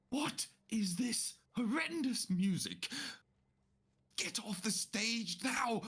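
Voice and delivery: high, slow outraged noblewoman; Seville outraged. Acted. Seville scolds the clarinet player, high and outraged. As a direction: scold the musician off the stage.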